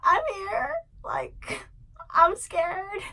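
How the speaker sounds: high, tearful, trembling retelling; high scared voice near crying; scared, on the verge of tears. Retold or imitated. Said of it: "I was like".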